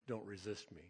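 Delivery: lightly voiced mystical voice, low and soft; mystical background voice. Voice heard in a dream of a bear, softly mystical. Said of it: "mystical background voice saying".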